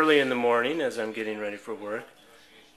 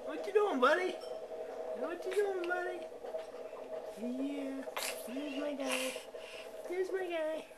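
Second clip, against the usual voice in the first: put-on high sing-song cooing at a toddler; baby talk. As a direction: coo at the baby, all delight. Cooing over walking baby son.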